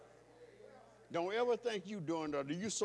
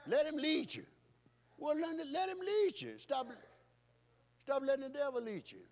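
preaching shout; 8 semitones higher than usual. Acted. Preacher urging congregation, high ringing shout. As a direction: lift it up and cry it out.

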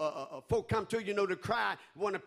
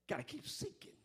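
breathy hushed urging, pitched up; urgent whisper; 9 semitones higher, 10 dB quieter than usual. Acted. Preacher urging the church in a whisper. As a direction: lean in and whisper the charge.

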